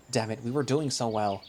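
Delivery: usual speaking voice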